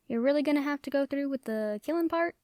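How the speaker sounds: ordinary talk, nothing marked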